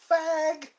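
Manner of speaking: high-pitched mock yell; imitated kid's yell. Kid yelling each time he rode by, imitated. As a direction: call it out high like a heckling kid. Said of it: "he would yell".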